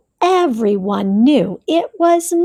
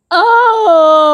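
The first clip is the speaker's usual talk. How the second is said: loud high yelp of surprise; startled cry; 5 semitones higher, 7 dB louder than usual. A narrator's reading. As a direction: cry out as you slip. Bear falls into puddle.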